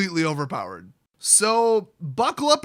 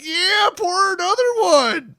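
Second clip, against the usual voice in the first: high, loud, slow mock drunk whoop; party girl shout; 10 semitones higher, 6 dB louder, slower than usual. Party girl yelling at the bar, hammed-up high loud whoop. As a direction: bellow it like the loudest girl at the bar. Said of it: "and be like".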